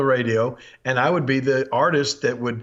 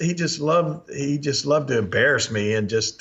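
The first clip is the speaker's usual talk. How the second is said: normal talking voice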